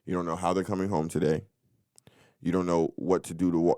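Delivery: ordinary talk, nothing marked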